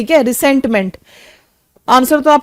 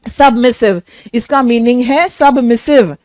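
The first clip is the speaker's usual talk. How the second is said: normal talking voice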